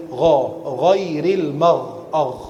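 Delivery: GHAIRIL MAGH slow, stopping on a throaty gh; drawn-out, heavy gha and agh; heavy gha demonstration. Shown as a demonstration. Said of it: "gha, bukan ghairi".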